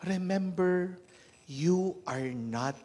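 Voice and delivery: slow, weighty sermon emphasis; emphatic preaching. Acted. Preacher warning the congregation, slow and forceful. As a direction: lean on each word for the congregation. Preacher drives home lesson.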